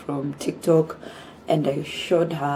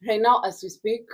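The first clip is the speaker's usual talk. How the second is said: ordinary delivery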